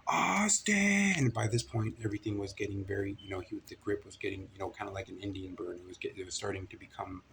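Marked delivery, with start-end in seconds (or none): 0.1-1.3 high, loud, long-held holler; second yell for help. Retold or imitated. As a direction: call out louder, desperate to be heard. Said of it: "I yelled out again".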